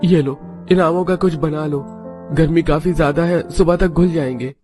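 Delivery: high-pitched character voice, downcast; disappointed mango seller home from work; dejected husband. Acted. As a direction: tell the family of the loss, disheartened. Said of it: "तीनों के पति मुंह लटकाकर घर आते हैं".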